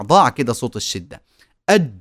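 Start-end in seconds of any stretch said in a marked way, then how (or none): 1.7-1.9 "أج" stopped hard as a short dj; short "أج", the sound held back completely; strong, trapped jeem. Shown as a demonstration. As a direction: lock the sound shut on the jeem of "أج". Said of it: "الصوت الجيم حرف صوت محبوس".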